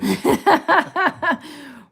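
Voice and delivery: short loud laugh. Real, not acted. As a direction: burst out laughing. Viewer comment read out.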